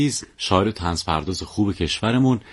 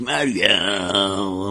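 one long drawn-out mock wail; imitated madman's cry; much slower than usual. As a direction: stretch the name like a lovesick lunatic. (تقلید فریاد کشدار دیوانه)

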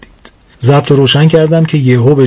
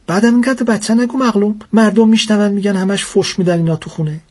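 1 mother's voice; 7 semitones higher than usual. Acted. Mother scolding husband.

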